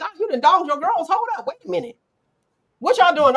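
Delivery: high, fast sing-song calling out; mock nosy woman. Woman running back to her girls, high and fast. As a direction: play the friend who ditched everyone.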